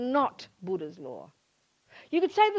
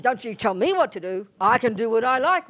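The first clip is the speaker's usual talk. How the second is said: loud indignant snap, rising to a shout; mock-defiant outburst; defiant retort; 8 dB louder than usual. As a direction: push back like a rebellious kid. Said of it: "you naturally think".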